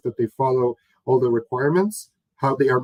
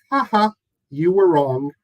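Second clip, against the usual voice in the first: higher, mock gloating "ha ha"; mocking laugh; 5 semitones higher, 4 dB louder than usual. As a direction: poke fun with a playful fake laugh.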